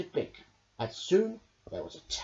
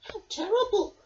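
fretting; 4 dB louder than usual. Acted. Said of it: "fretted Rabbit".

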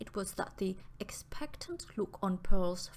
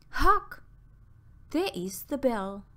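sharp loud high call to listen; sudden alert; 4 semitones higher, 7 dB louder than usual. Acted. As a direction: cut in, alarmed and loud. Sudden alarm at the bell.

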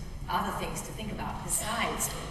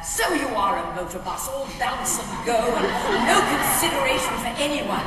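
mock scolding, louder and rising; Eliza telling him off; 5 semitones higher, 8 dB louder than usual. Actress replaying Eliza's line at Rex, a louder mock scold. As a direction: give him a spirited stage scolding. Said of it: "I had lines like".

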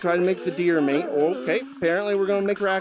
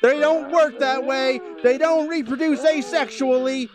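higher, louder exasperated rant; frustrated shouting rant; 6 semitones higher, 3 dB louder than usual. Real, not acted. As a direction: rant at the game in disbelief. Ranting loudly at the game.

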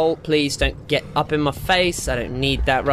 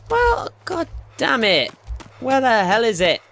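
frustrated outburst; 9 semitones higher than usual. Real, not acted. Frustrated, can't find crash.

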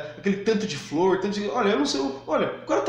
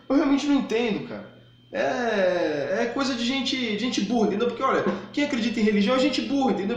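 ordinary talk

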